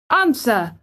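high one-word command; imperious. A narrator's reading. Boy orders the silent gardener to reply, imperious. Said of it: "demanded Colin still more imperiously".

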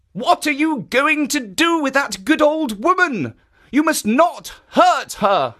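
a lightly voiced kindly guardian; kindly statue voice. Jitso speaks.

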